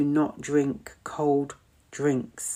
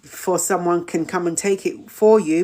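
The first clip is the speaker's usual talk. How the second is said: ordinary talk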